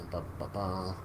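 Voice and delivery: normal talking voice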